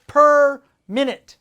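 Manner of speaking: normal talking voice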